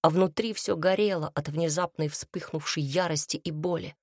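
ordinary delivery

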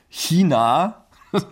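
laughing through the word China; amused laugh on the word China. Real, not acted. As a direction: chuckle at how naive it sounded. Laughing at own naive belief.

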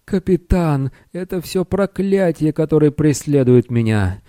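high, distressed little wizard; wailing. A narrator's reading. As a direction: wail to the captain in a thin high voice. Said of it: "нарушенное воплем Сниша".

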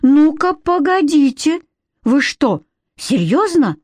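put-on high, indignant boyish protest. A narrator's reading. Boy objecting to the fairy council, indignant. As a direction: break in high and incredulous.

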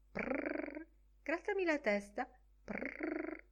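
high cat voice between soft purrs; purring cat voice. Retold or imitated. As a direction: purr it like the cat asking. Bloom fa parlare la gatta. (Bloom immagina la gatta che fa le fusa e chiede carezze)

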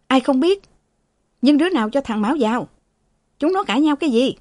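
sharp, high irritated snap; a sick old master snapping at his servant. Acted. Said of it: "Thừa gắt".

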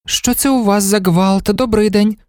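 high, light voice greeting colleagues; curious newcomer. A narrator's reading. Typist walking in on a quarrel, curious greeting. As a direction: enter with a high-pitched, puzzled greeting.

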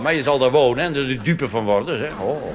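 ordinary talk, nothing marked

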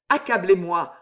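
usual speaking voice

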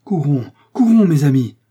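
loud, high rallying cry; urgent cry. A narrator's reading. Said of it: "s'écria D'Artagnan".

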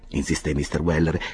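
usual speaking voice

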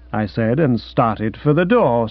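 ordinary delivery, nothing marked

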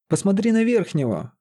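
high-pitched urgent plea; imploring. A narrator's reading. Sorcerer points Лиза to the injured mage, imploring. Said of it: "взмолился чародей".

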